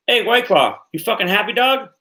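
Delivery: usual speaking voice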